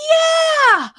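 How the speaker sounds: high excited yelp. Real, not acted. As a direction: burst out in delight. Excited agreement.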